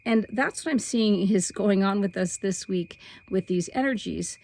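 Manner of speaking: ordinary delivery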